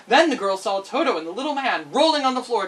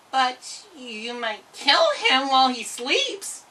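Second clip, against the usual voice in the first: slow, sly soft cat voice; 5 semitones higher, slower than usual. Acted. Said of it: "suggested the cat in a soft voice".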